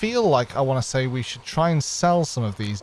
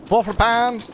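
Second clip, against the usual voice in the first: market trader call; 9 semitones higher, 3 dB louder than usual. Pretend.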